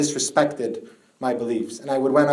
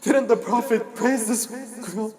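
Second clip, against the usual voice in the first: high, strained elegy chant of grief; keen the tragedy as a mourning recitation; mourning lament; 9 semitones higher than usual. Acted. Preacher reciting Fatima's death to mourners, high grieving lament.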